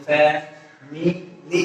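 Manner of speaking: slow ফ্যামিলি split into fa-mi-li; three-syllable pronunciation. Shown as a demonstration. Teacher showing the familiar word, ফ্যামিলি slowly as fa-mi-li. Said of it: "আমরা সবসময় উচ্চারণ করি ফ্যামিলি".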